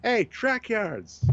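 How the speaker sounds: high, drawn-out hail at moderate volume; imitated yell. Host retells a fan hailing him in a parking lot, mock yell. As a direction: call out slowly as if across a parking lot. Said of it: "I was walking in and I hear".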